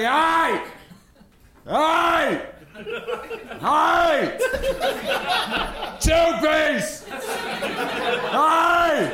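high yelling hey. Pretend. Yelling at the chicken counter to be served. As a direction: call out high to get the cashier's attention. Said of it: "Just give him a quick".

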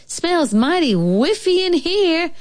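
high, slow motherly bear; drawn-out high mama bear voice. A narrator's reading. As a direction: sniff out the words high and slowly. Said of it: "said Mama Bear".

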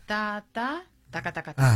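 sílabas percusivas en voz baja; ritmo de bachata. Shown as a demonstration. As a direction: demuestra suavemente el compás. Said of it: "a ver". (tarareo rítmico y suave)

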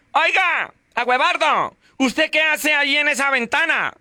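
grito agudo de esposa; llámalo a gritos desde abajo; mujer gritando. Retold or imitated. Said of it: "la mujer le grita". (la esposa le grita al marido en la ventana)

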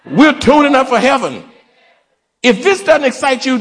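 high, exultant preacher's cry; fervent preaching. Real, not acted. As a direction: rouse the congregation with high, ringing phrases. Preaches at full force.

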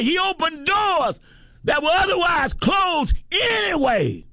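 high, shouted preaching on every anyway; preaching at full force. Acted.

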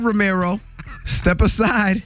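normal talking voice

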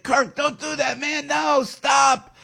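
high, pleading yell that rises to a shout. Retold or imitated. Yelling at Mayor Kirk on the TV, retold pleading shout. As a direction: plead with the screen, then shout stop. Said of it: "I'm yelling at the T V".

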